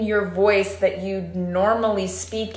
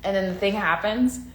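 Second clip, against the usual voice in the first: ordinary delivery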